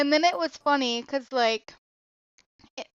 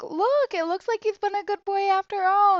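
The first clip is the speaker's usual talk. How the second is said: higher, fast singsong mom; imitating mom, fast and higher; 7 semitones higher than usual. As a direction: brightly point it out, higher. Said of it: "even my mom is like".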